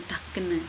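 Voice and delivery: takene, softly, keeping the final E; Watu Wawer takene. Shown as a demonstration. Lecturer repeating the example softly, takene with final E. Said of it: "itu kayak ada E di belakang begitu".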